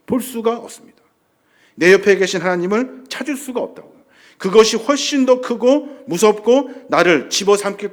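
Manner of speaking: loud, mounting sermon list; impassioned pulpit delivery; forceful preaching. Real, not acted. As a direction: pile up each phrase with rising force.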